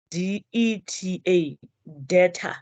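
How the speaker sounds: letters D-E-T-A, then debtor without b; debtor spelt by sound. Shown as a demonstration. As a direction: spell out DETA, then say debtor. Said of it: "So it's pronounced as".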